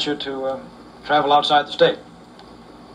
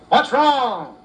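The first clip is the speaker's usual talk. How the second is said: higher, raised shout over the engine; calling down from the cab; 8 semitones higher than usual. Acted. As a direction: yell it from the train.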